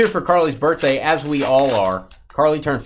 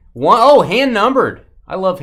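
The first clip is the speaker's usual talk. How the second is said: excited; 7 semitones higher, 10 dB louder than usual. Real, not acted. Excited at a numbered card.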